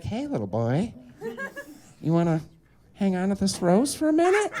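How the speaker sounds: soft, sly hag voice; creepy old hag. Retold or imitated. Witch offering the rose to the boy, soft and sly. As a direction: tempt him like a witch at the door. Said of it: "she's like".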